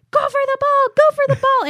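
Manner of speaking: high, loud sideline soccer-mom yell; mock yelling. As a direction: holler it across the field, imitating a fired-up mother. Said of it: "she's, like, yelling".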